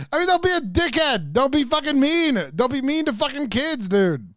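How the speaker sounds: high, loud scolding rant; exasperated yell. Real, not acted. Streamer telling off a man mean to kids, yelling high. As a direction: yell it high, pleading for basic decency.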